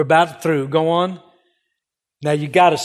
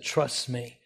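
much softer, tender invitation; gentle Jesus voice; 4 semitones lower, 12 dB quieter than usual. Retold or imitated. Missionary voicing Jesus to supporters, soft and tender. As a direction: say it softly, like an offer of comfort. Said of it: "Jesus said".

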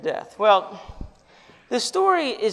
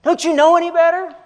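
loud, higher scolding voice; scolding lecture; 8 semitones higher, 9 dB louder than usual. Retold or imitated. God pictured lecturing the rescued sinner, angry scolding. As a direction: scold like a stern father. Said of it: "begins to give them a lecture".

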